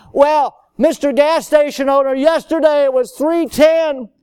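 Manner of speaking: loud, high-pitched mock protest; arguing customer. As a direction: argue the price like an indignant customer. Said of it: "You can't go argue and say".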